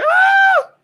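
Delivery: loud, very high shriek; full-throated imitated rider scream; mock scream. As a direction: shriek like a little girl on a ride. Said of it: "That's me screaming".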